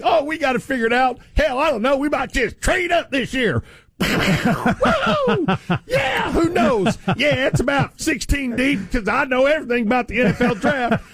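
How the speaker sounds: hammed-up boastful owner voice; mocking the owners. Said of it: "they act like they've got".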